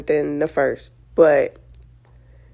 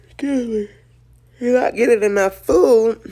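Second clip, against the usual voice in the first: real tears breaking through, pitched up; 6 semitones higher than usual. Real, not acted. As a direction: choke up and half laugh through crying. Breaks down talking about baby.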